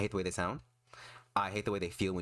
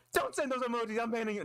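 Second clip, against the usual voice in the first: mock scolding. Mock scolding chat over emojis.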